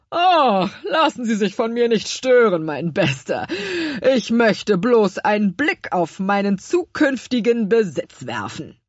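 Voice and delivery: pompous baker gloating over the café; smug fat baker. Acted. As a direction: savour every word like a villain.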